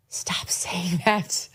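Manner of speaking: whispered plea to stop saying it; hushed urging. Retold or imitated. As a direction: lean in and whisper it like a warning. Host voicing advice to KJP.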